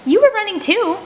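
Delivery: a high, loud indignant retort. Acted. The narrator snapping back at Rocky, indignant and loud. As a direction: throw it back at him, outraged.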